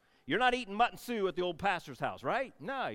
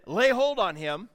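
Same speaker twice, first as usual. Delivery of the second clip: loud, drawn-out royal order; commanding shout; 2 semitones higher, 7 dB louder, slower than usual. Acted. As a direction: call it out slowly as a command. Requotes king's arrest order.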